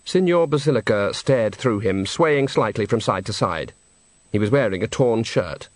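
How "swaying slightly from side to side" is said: ordinary delivery, nothing marked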